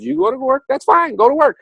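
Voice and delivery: the universe telling Richard fine, go, mockingly high; universe giving in. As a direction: toss it off sarcastically, high.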